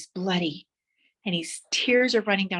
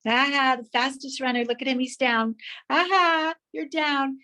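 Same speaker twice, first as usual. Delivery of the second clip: lightly voiced taunting crowd, higher; the crowd laughing at the fallen runner, higher mild mockery; mild mocking jeer; 6 semitones higher than usual. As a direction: tease him like a smug spectator.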